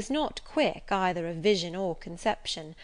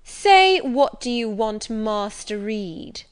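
mocking echo; 3 semitones higher, 9 dB louder, slower than usual. A narrator's reading. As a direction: throw her words back at her to humiliate. Bully mocks her question.

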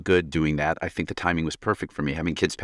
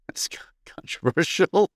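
high breathy whisper, laughing; amused whisper; 11 semitones higher, slower than usual. Acted. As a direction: whisper it, half laughing, mock scandalised. Host mock-shocked at question.

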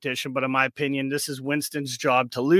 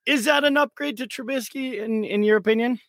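voice giving out; 7 semitones higher than usual. Real, not acted. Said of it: "Sorry about my voice".

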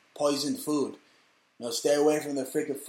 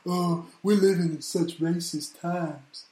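mocking whiny celebrity; 2 semitones higher than usual. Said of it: "all these idiots".

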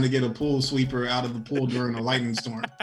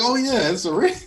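mock realization; 8 semitones higher than usual. Realizing it's a ring.

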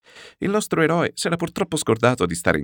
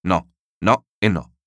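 slow, louder, each no hammered; firm no; 5 dB louder than usual. Acted. Refusing to raise the price, a slow emphatic no. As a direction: refuse flatly, one no at a time. Flat refusal while haggling.

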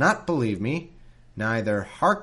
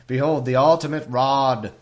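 mock grand Moses; 6 dB louder than usual.

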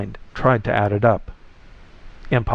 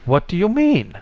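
higher-pitched indignant question; offended and demanding, voice raised in pitch; 7 semitones higher than usual. A narrator's reading. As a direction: demand it, affronted, pitch lifted high. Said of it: "the indignant flesh attached to the tie demanded".

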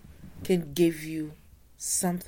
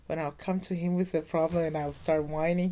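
usual speaking voice

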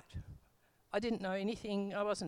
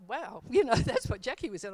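louder, higher burst of joy with a laugh; excited; 4 semitones higher, 10 dB louder than usual. Retold or imitated. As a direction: relive the thrill of realising it, laughing. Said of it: "I was so excited".

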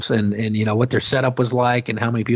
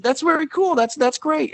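lightly higher friendly reply; himself, pleased. Retold or imitated. Host answers the excited fan, a touch higher and friendly. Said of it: "and so I said".

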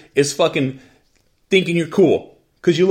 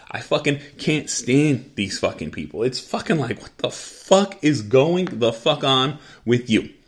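ordinary delivery

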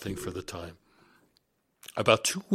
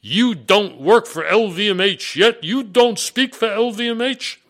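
imitated screaming; 11 semitones higher, 12 dB louder than usual. French HR chief berating a recruit by phone, imitated screaming. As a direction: mimic an outraged boss yelling each word. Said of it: "screaming at me on the phone".

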